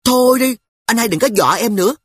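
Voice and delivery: put-on high, loud and quick girl's forced toughness; a scared kid acting brave; putting on bravado. Sister to teasing brother, high forced brave girl voice. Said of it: "cố làm ra vẻ cứng".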